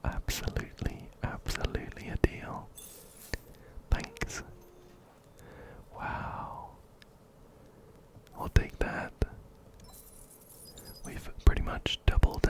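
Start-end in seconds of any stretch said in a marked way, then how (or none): none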